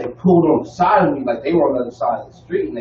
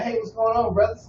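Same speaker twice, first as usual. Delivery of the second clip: brotherly greeting; 8 semitones higher, 6 dB quieter than usual. Retold or imitated. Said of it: "with an attitude instead of just like".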